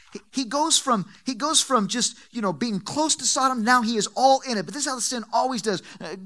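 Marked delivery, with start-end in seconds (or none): none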